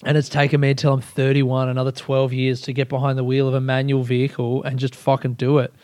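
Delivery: ordinary talk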